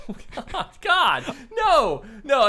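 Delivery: mock panicked refusal. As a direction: recoil from the fish. Groom refusing the fish.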